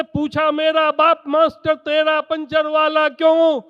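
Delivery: ordinary delivery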